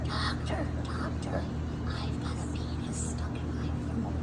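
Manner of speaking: low whispered woman's voice. Acted. A woman telling her doctor her problem, in a hushed whisper. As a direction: breathe it out like a woman confessing an embarrassing problem. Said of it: "I gotta sip on a beer to get my voice that low".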